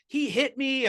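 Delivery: high-pitched imitated kid tattling; lightly voiced kid complaining to the teacher; whiny kid. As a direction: mimic a child telling on a classmate.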